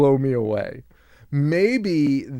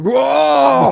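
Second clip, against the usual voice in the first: loud, high drawn-out "whoa"; mock outraged yell; 14 semitones higher, 13 dB louder than usual. Host imitating Norman reacting to the radio hosts, mock outraged yell. Acting out caller's angry reaction.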